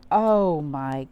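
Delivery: plain reading voice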